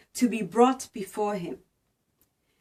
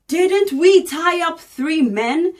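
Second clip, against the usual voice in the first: louder, higher, startled question to his advisors; amazed exclamation; 4 semitones higher, 7 dB louder than usual. A narrator's reading. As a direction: blurt it out as if you can't believe your eyes. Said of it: "jumped up in amazement and exclaimed".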